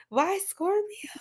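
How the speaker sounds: slow, high whispery question; softly, slowly puzzle over the guess; baffled whisper. Real, not acted.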